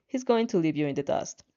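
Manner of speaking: usual speaking voice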